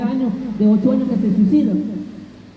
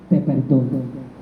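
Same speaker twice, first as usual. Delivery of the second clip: gentle forgiveness; 7 semitones lower, 3 dB quieter, slower than usual. Retold or imitated. Forgiven person answers gently.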